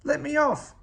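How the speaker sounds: rising to a loud, high panicked yell. Acted. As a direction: build from dismay to a full shout to get off. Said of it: "yelled Oscar".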